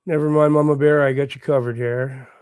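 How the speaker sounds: normal talking voice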